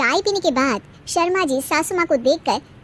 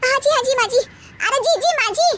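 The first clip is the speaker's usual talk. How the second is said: eager higher agreement; 9 semitones higher than usual. Acted.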